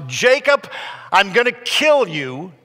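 loud, high-pitched enraged shout; furious yell. Acted. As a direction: yell it out as a threat to your brother. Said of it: "And he yells out".

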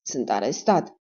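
low, hurt murmur of disbelief; pained. A narrator's reading. Dentist alone in his cell, a pained low murmur. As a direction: say it to yourself, stunned and aching. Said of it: "își spunea îndurerat".

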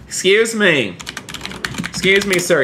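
high-pitched, put-out "hey you" tone; indignant call-out. Pretend. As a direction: call out a driver cutting in. Challenges driver cutting in front.

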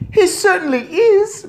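very high, loud squeak; shrill squeaky shout of agreement; shouting mouse boy. A narrator's reading. Mouse boy agrees from inside handbag, squeaky shout. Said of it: "Bruno shouted from inside the handbag".

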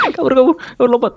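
high frantic run shout; panicking gamer. Real, not acted. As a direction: yell at yourself to flee. Fleeing in a fight.

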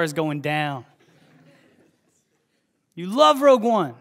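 loud, rising preacher's appeal; preaching at full force. Acted. Pastor pressing the congregation, loud preaching. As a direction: drive it home to the crowd.

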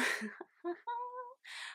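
high, excited squeal of a laugh. Real, not acted. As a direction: giggle with nervous glee. Laughs turning the corner.

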